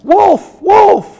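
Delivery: very high, loud call; yell. Shown as a demonstration. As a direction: shout it out loud. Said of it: "I want you to all yell out".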